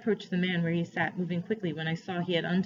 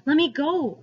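higher, louder cry of protest; 8 semitones higher, 6 dB louder than usual. A narrator's reading. Boy pulling back from the man's grip, crying out. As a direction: cry out, struggling to break free. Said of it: "I cried".

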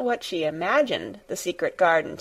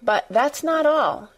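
higher, earnest build-up; solemn excitement; 6 semitones higher than usual. A narrator's reading. Girl about to reveal the garden secret, solemnly excited. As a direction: hold back excitement, pitch lifted. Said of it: "almost pale with solemn excitement".

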